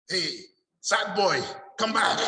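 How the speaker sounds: high, loud delighted call; calling back, excited. Retold or imitated. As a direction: summon him back with a sudden thrill. Teacher calls him back excited.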